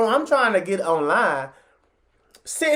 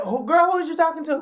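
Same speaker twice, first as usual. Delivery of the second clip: sassy comeback; 9 semitones higher than usual. Retold or imitated. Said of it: "I looked at her, I said".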